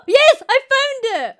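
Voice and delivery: high, loud triumphant yell; excited cry. Real, not acted. Streamer finds the artist's tweet, loud triumphant yell. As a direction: shout it like you just won.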